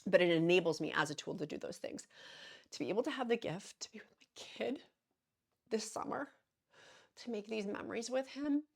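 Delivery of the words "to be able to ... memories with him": whispered through tears, much softer; barely holding it together, whispering; tearful whisper. Real, not acted. About summer memories with her son, a tearful whisper. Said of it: "I need to stop crying".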